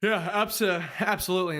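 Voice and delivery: talking through a yawn. Real, not acted. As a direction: say it mid-yawn, sleepy. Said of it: "sorry if I'm yawning".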